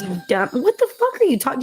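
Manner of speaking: ordinary delivery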